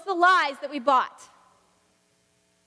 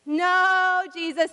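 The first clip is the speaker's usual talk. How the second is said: a raised no, louder; loud arguing; 5 semitones higher, 6 dB louder, much slower than usual. Acted. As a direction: shout the denial back. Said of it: "they argued".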